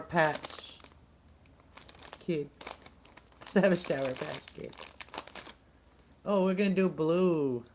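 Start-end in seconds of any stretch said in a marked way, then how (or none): none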